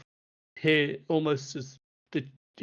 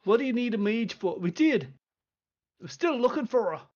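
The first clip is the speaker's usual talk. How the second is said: higher, gruff dwarf chiding; a half-dressed dwarf lord losing patience; impatient dwarf; 8 semitones higher, 4 dB louder than usual. Acted. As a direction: Doran scolding the visitor. Dwarf questioning.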